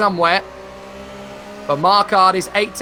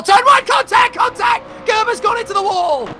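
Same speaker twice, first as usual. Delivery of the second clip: very high, loud alarmed yell; alarm shout; 12 semitones higher, 3 dB louder than usual. Real, not acted. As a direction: cry out as the car hits the wall. Crash called live.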